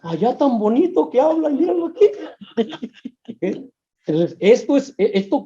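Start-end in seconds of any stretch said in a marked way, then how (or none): 0.0-2.1 mock complaint. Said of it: "Y a veces dices".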